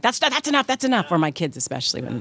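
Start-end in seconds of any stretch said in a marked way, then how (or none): 0.0-1.1 nervous plea. Retold or imitated. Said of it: "I'm like".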